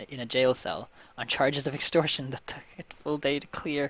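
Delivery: ordinary delivery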